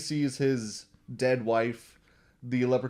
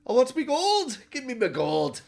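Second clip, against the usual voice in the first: leprechaun demand; 12 semitones higher, 4 dB louder than usual. Retold or imitated. Leprechaun demanding gold back.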